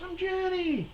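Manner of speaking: put-on high girly voice. Comic voicing the concert girl, very high falsetto. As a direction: shriek it like a bubbly girl.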